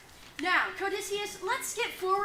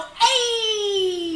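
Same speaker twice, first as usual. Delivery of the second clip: shouting; 4 semitones higher, 12 dB louder than usual. Acted. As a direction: shout A slowly. Yelling the name.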